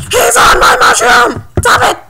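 high, loud tantrum yell; mock outraged shriek. Player spotting someone on the mushroom, mock outraged shriek.

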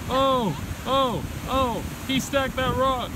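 high, slow, thrilled announcement; excited. Real, not acted. Cheering the rock stack.

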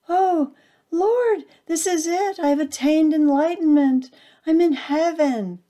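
very high, loud ecstatic cry; ecstatic rapture. A narrator's reading. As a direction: burst out in holy rapture at the light. Acorn reaches the light.

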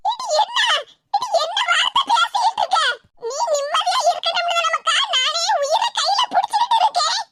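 aachi cut to the heart, crying out high; hurt grandmother. Acted. Grandmother wounded by the girl's words, high tearful plea.

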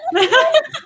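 short high burst of real laughter. Real, not acted. Cracking up at a friend's joke about gifts, high laugh. Reacts to boyfriend gift joke.

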